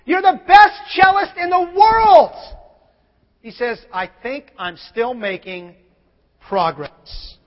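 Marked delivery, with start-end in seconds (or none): 0.0-2.3 raised, louder, slow amazed question; admiring questioner. Retold or imitated. Questioner praising the famous cellist. 3.9-7.4 humble reply. Retold or imitated.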